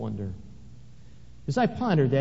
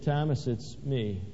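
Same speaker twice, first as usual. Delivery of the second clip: soft, gentle voice, much quieter; tender Jesus; 3 semitones lower, 6 dB quieter than usual. Acted. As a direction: say it softly, like a reassurance. Jesus speaks gently to Thomas.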